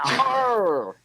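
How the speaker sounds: high growling pirate "Arr". Pretend. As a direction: give it a hearty pirate arr. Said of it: "International Talk Like a Pirate Day".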